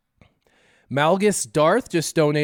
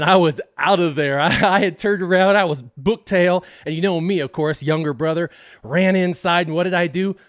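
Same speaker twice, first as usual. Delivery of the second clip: ordinary delivery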